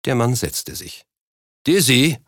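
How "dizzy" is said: raised, higher call; loud hail to someone out of sight. A narrator's reading. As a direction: call her name towards another room. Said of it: "rief er".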